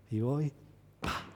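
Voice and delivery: usual speaking voice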